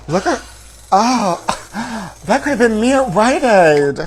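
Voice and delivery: gasps, then high loud dismay; shocked gasp at the footage. Real, not acted. Watching the arrest clip, gasping shocked outburst.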